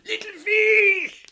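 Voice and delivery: very high, slow stretched shout; fisherman's long drawn cry to the fish in the storm. Acted. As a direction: hurl the call long across the waves. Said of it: "he yelled out".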